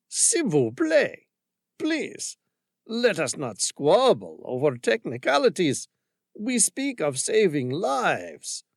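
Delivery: put-on high, slow French-accented plea; French commander pleading with the woman. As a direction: beg in a heavy French accent. Frenchman pleads for his life.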